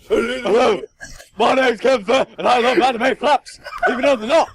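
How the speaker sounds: mock impression of a friend. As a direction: tease him by playing him, half-laughing. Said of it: "your impression of my impression".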